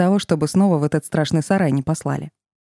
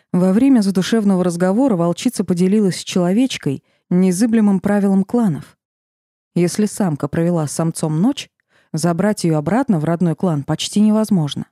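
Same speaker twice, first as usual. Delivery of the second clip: ordinary delivery, nothing marked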